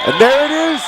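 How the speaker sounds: high, loud burst; excited shouting. Real, not acted. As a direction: blurt the big moment out. Decisive hit called.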